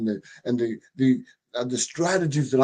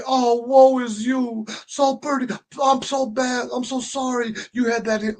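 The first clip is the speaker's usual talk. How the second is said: overdone fake pity, voice lifted high; hammed-up sympathetic onlooker; mock pity; 9 semitones higher than usual. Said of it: "they aren't gonna say".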